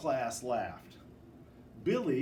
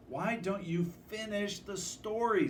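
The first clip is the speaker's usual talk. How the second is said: put-on higher, pleading child's voice; 6 semitones higher than usual. A narrator's reading. Zach begging the class amid chaos, light pleading. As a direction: beg them to finish, voiced lightly. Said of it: "Zach pleaded".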